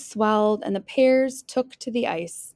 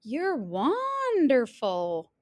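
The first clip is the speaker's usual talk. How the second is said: slow, drawn-out swoon of praise; gushing admiration; 3 semitones higher, much slower than usual. Acted. Wolf admires Little Red's skating, gushing. Wolf gushes after howl.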